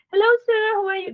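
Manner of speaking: quick bright hello to a client; a touch too eager on the phone; eager greeting, slightly high. Shown as a demonstration. Rushing into a client call too eagerly. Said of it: "No, don't start".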